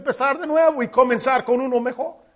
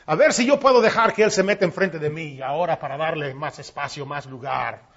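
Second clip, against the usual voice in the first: quick, lower and sarcastic; mock the saintly driver; sarcastic driver voicing fake kindness; mock generous; 7 semitones lower, faster than usual. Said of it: "lo que tú sientes es".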